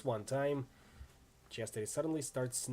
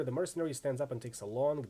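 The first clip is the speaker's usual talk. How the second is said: ordinary delivery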